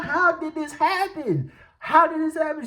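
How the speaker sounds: high, baffled questioning; retold bewildered pleading. Storyteller replaying asking mom what happened, high mock bewilderment. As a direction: plead it high, mimicking your own confusion.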